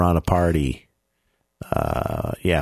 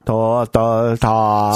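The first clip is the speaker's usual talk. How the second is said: robot voice; much slower than usual. Retold or imitated. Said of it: "Sounds like Stephen Hawking".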